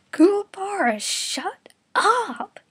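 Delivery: high, loud put-on scream. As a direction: scream at him to shut up. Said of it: "Yusuke screamed at him".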